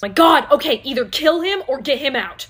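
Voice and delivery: loud, pressed plea; frantic order to get rid of the guest; panicked urging. Acted. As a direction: hiss it out in a panic.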